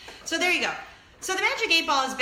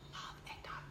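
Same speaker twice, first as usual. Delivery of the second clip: secret whisper; 21 dB quieter than usual. Real, not acted. Host confessing to viewers she loves eggnog, whispering.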